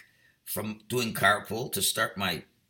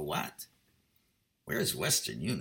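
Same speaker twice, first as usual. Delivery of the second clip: lower, softer puzzled echo of the question; baffled echo; 9 semitones lower, 7 dB quieter than usual. Retold or imitated. Rabbi echoing the strangers' question in soft bewilderment. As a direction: repeat it back, confused and quiet. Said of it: "I go".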